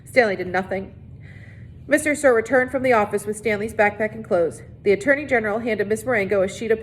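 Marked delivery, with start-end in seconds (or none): none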